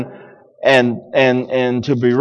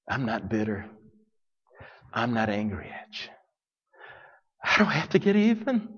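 softer, almost whispered promise; hushed reassurance; 8 semitones lower, 14 dB quieter than usual. Acted. Joseph softly telling his brothers he's not angry. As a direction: say it softly so they stop fearing.

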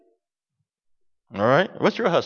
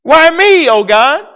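complaining David; 8 semitones higher, 5 dB louder, slower than usual. Retold or imitated. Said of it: "why are you hiding".

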